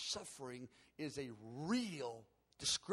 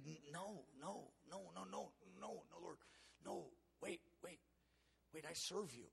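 hushed protest; 9 dB quieter than usual. Retold or imitated. Protesting in the retold vision.

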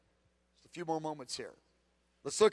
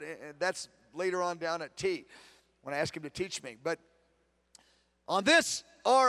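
ordinary talk, nothing marked

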